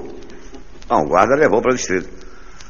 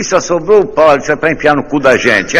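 cheeky loud punchline; 6 semitones higher, 4 dB louder than usual. Acted.

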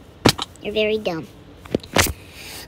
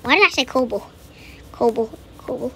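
ordinary talk

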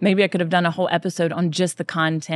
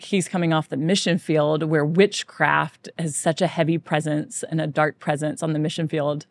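ordinary talk

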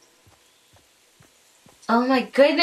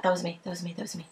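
ordinary talk, nothing marked

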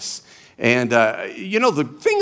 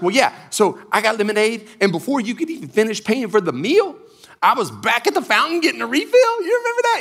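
put-on high child voice, climbing higher; young daughter; 8 semitones higher than usual. Daughter tells dad about the refill, imitated child voice. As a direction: imitate a daughter eagerly telling her story. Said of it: "And she's like".

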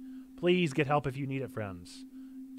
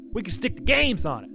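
higher, louder voiced take; character voice; 7 semitones higher, 5 dB louder than usual. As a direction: give the line a character voice.

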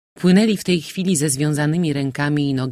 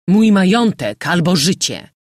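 furious, loud, higher outcry; terrible fury; 4 semitones higher, 7 dB louder than usual. A narrator's reading. General to the corsairs, furious shout. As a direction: rage at them for your fortune. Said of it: "w straszliwej furii".

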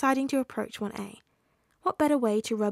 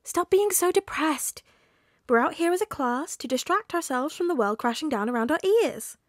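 whining; 6 semitones higher, 5 dB louder than usual. A narrator's reading. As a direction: whine at the class to cheer up. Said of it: "Ashida whined".

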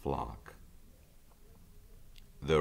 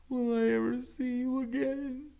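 put-on much higher young goose voice; tearful gosling. Brightbill to his departing mother, high and tearful. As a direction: ask it as a crying little goose. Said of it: "said Brightbill, wiping his eyes".

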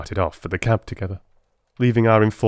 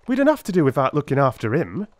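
aggrieved; 6 semitones higher, faster than usual. A narrator's reading. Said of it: "in an aggrieved voice".

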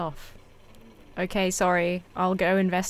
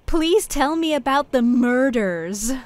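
slow, higher, exaggerated American accent; drawn-out American R's in "murders", pitched up; put-on American accent; 4 semitones higher, slower than usual. Shown as a demonstration. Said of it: "I have to say murders in an American accent".

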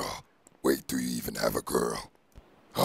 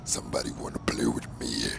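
whispered symbiote voice; breathy whispered monster; Venom whisper. Acted. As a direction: rasp it out as the creature in his head.

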